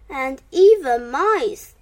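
ordinary delivery

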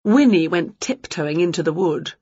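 ordinary delivery

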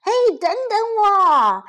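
high, loud wail after the others; high-pitched pleading storybook shout; calling out. A narrator's reading. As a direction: cry out to be waited for. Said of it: "希拉里叫道。".

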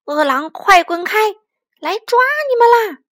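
loud threat rising to a high cry; 威吓喊叫. Acted. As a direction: build up to scare the wolves away. 妈妈示范吓跑恶狼. (越喊越高地威吓)

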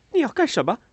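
high, loud alarmed question; sharp and on guard; wary. A narrator's reading. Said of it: "艾达警惕地说".

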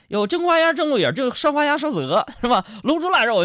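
high, loud comic crosstalk menu patter; menu recital. Acted. Comedian showing off a film banquet, high crosstalk recital. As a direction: rattle off dishes like a crosstalk performer. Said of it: "上面报菜名我不会呀".

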